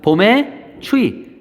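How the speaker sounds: 봄의 추위 spelled out very slowly; slow teacher gloss of 봄의 추위. Shown as a demonstration. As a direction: drag out each syllable of 봄의 추위 for the class. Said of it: "이거는 봄 춘자".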